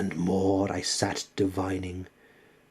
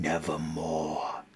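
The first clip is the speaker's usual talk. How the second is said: low raven reply; 4 semitones lower, 5 dB quieter than usual. Acted. The raven answers.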